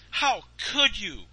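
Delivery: put-on high, slow, dismayed child voice; shocked boy. Little hero confronting Santa, high dismayed plea. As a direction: plead in disbelief.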